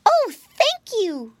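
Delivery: very high, loud, drawn-out thanks; high childlike exclamation; piglet's grateful cry. A narrator's reading. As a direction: squeal slowly like a thrilled little pig. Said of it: "cried Flop Ear".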